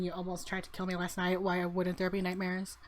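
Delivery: usual speaking voice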